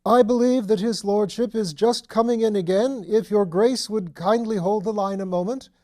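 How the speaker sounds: high, loud, strained servant's phone voice; strident butler on the telephone. Acted. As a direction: push it out stiffly like a trained valet on the line. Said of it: "throttled stridency peculiar to well-trained persons using the telephone".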